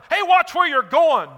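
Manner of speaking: shouting at a blind man, hammed-up; mock scream. Scolding a blind stranger, mock shout. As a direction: bark it out like an irritated passerby. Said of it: "Do you scream at them".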